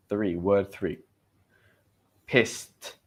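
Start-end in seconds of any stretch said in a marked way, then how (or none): 2.3-2.9 "Pissed" ending in a crisp T after the S; clear final T. Shown as a demonstration. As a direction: finish "Pissed" on a distinct T sound. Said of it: "get the pronunciation".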